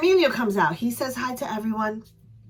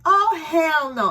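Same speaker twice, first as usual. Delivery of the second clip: slow, louder defiant "hell no"; defiant attitude; 7 semitones higher, 6 dB louder, much slower than usual. Retold or imitated. Fan refusing a drunk girl shoving her, slow and defiant. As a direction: stand your ground with attitude.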